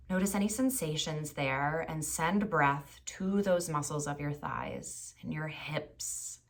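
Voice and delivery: calm body-scan voice with long pauses; guided meditation. Acted. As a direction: guide them gently through the body, unhurried.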